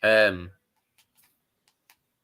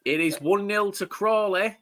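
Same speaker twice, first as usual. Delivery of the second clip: gleeful; 11 semitones higher, slower than usual. Real, not acted. Repeating the score.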